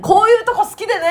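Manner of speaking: high, bright quoted catchphrase; imitating junior's tsukkomi. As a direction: quote the junior's line playfully. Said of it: "って言うんですよ".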